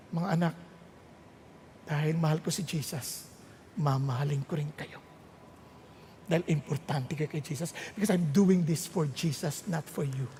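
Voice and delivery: very soft, low, loving reassurance; tender parent. Acted. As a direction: speak gently to your children. Voices a loving parent, contrasting the nag.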